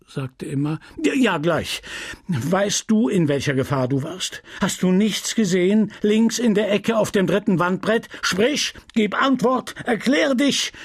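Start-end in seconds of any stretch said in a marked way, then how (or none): 1.0-10.7 raised, indignant scolding voice; the pharmacist fuming at his apprentice; a lightly voiced furious old apothecary; angry apothecary. Homais interrogating Justin.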